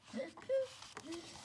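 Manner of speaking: short soft high laugh; soft amused giggle; light laugh. Real, not acted. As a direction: chuckle at the funny web. Laugh at the pig web.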